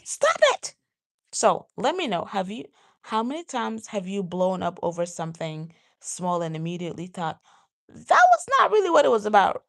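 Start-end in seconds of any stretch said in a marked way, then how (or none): 0.0-0.8 high, loud burst of "stop it"; acted explosion. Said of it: "So you have this explosion". 7.9-9.6 high, loud, quicker self-quote; acted realization. Replaying the thought after blowing up, high loud blurt.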